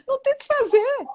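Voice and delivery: high-pitched, laughing disbelief; helpless laugh on the words. Real, not acted. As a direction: squeal it out through laughter. Laughing at absurd marriage question.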